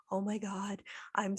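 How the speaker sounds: hushed, breathy whisper; whispered quote. Retold or imitated. Clerk apologizing to the customer, whispered. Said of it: "And she was like".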